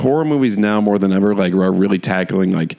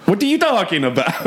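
a high, lightly put-on Italian protest; half-joking Italian accent, voice jumps up; imitating offended Italians; 12 semitones higher than usual. Italian viewers scoffing at the actor's accent, lightly mocked Italian accent. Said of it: "Italians that are like".

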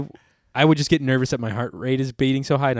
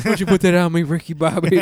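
higher, louder joking protest; hammed-up offended protest; mock outrage; 5 semitones higher, 6 dB louder than usual.

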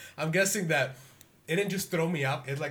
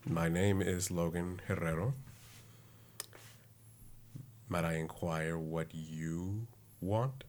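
lower, softer, slow and courtly; mock formal reply; 7 semitones lower, 8 dB quieter, slower than usual. Logan answering the Rat King, stiff and courtly. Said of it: "I'm like".

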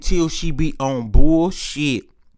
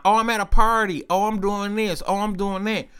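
lightly mimicked girls, pitched up in a repeating lilt; mock excuses; 6 semitones higher than usual. Girls brushing off his call, mock higher-pitched excuses.

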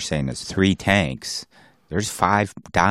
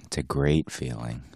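much softer, lower echo of the line; quiet echo; 6 semitones lower, 11 dB quieter than usual. Acted. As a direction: murmur it back, dreamy. Echoing the line dreamily.

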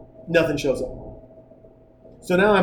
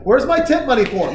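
put-on kid voice; 6 semitones higher, 3 dB louder than usual. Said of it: "Harper's like".

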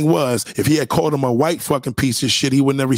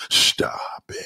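mock whisper; 4 dB quieter than usual. Mock whining stop it.

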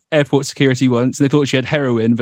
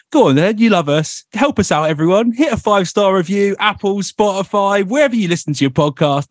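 a hint of a cheery presenter plug, higher; hosting bit; 7 semitones higher than usual. Shown as a demonstration. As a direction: pitch it up like a host begging for reviews. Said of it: "Shall I do some sort of, like, hosting bit".

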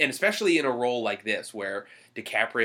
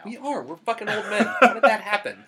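laughing; 9 semitones higher, 4 dB louder than usual. Real, not acted. Joking about getting old.

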